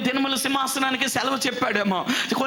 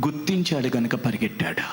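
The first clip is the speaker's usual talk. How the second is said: whisper; 9 semitones lower than usual. Real, not acted. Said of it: "hushed narration".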